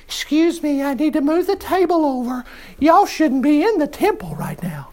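hushed, high, overly polite joke voice; mock polite Jesus. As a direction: tiptoe through it like a meek apologizer. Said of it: "No, he had a whip".

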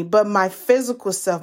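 ordinary talk, nothing marked

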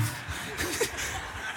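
silent airy laugh; breathless wheezing laugh. Real, not acted. Preacher cracking up at his own kid impression.

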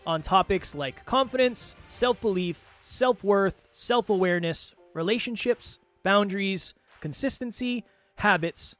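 upbeat podcast promo read; life coach. Acted. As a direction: pitch the show like an ad. Show intro pitch read.

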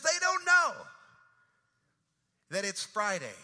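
rising and falling preacher's cadence, building to loud peaks; preaching. Retold or imitated. Pastor preaching the Friday sermon to his church, rolling cadence.

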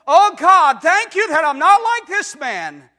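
loud, high, pompous prayer; proud Pharisee. Retold or imitated. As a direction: pray like a boastful Pharisee. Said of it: "that old Pharisee in the temple".

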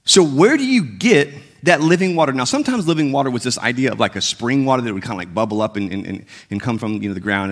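normal talking voice

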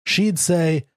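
ordinary delivery, nothing marked

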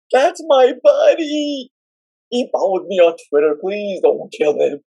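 pleading cry. Retold or imitated. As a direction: beg them to spare him, voice breaking. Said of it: "I'm sitting there going".

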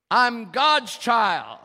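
loud, drawn-out congregational declaration. Shown as a demonstration. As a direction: lead the crowd in a high, slow shout. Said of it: "Say it with me out loud".

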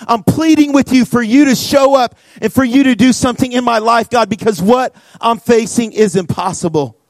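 acted-out desperate plea; crying out to God. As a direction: plead urgently as if praying for your life. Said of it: "He cries out to God".

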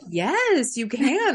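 retold excitement. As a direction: re-enact an eager, delighted permission. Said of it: "I was so excited".

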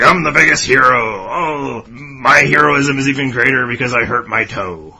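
loud whiny hero boast; hammed-up self-pitying hero; Rupert impression. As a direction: moan about the toe like a martyr. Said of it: "it's like".

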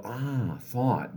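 soft, unbothered acknowledgment; note it gently and let it pass; calm noting. Shown as a demonstration. Coach shows meditators noticing a stray thought, calmly. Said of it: "calmly say".

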